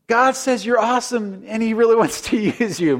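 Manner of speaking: high, hesitant second try with a nervous laugh. Retold or imitated. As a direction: say it again, losing nerve halfway. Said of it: "Maybe less confident the second time".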